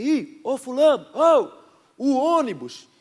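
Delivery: high, loud slow call across the street. Retold or imitated. Pastor calling his friend to the bus, loud call. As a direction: yell to a friend to hurry for the bus.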